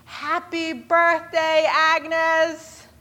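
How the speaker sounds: high, loud, drawn-out surprise shout; erupting cheer. Retold or imitated. Whole diner greets Agnes as she comes in, erupting cheer. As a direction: cheer it slowly like a whole crowd. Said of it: "the whole place erupts and says".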